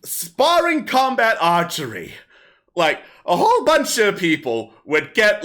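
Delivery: bawdy kobold comic on stage; kobold comedian. Acted. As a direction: perform it like a street comic working a crowd. Kobold comic continues his act.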